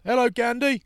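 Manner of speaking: high, loud jeering call; high teasing greeting shouted at a star; mocking heckle. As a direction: sing it out like a cheeky heckler. Heckler in Extras scene.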